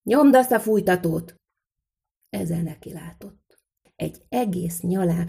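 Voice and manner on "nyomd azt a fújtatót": loud, higher-pitched command; shouted order. A narrator's reading. Smith to the boy at the bellows, shouted. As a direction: call it out loudly as an order. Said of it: "és elkiáltotta magát".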